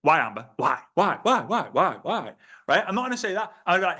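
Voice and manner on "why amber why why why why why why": repeated pushy "Why?" again and again; nagging why. Shown as a demonstration. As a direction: badger her with why. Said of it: "just gonna go".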